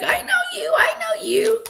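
very high, loud squealing I know you; high-pitched excited shout repeated; excited fan squeal. Pretend. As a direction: shriek with delight at recognizing strangers. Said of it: "I'm gonna be like".

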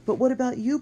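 high, loud, alarmed objection; protesting. A narrator's reading. Boy refusing to leave his friend, high protest. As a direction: object with urgent worry. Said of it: "protested Matt".

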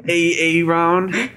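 high, stern teacher calling roll; substitute teacher. Retold or imitated. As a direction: mangle the name like a stern sub. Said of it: "that video with Key and Peele".

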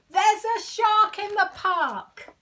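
very high, very loud full shout. Acted. Boy shouts his alarm across the park. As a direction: belt the warning out at the top of your lungs. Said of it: "about to shout".

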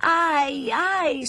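high-pitched ows; wailing complaint. Acted. Toy blocks fallen from the tower, wailing. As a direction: cry out as if knocked over. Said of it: "si lamentarono i blocchetti".

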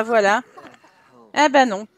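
ordinary talk, nothing marked

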